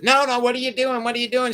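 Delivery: high, fast panicked protest, mock alarm; imitating a frantic girlfriend, pitch way up; retold freaking out. Friend's girlfriend panics at the offer to see giants, high and rushed. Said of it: "She starts freaking out".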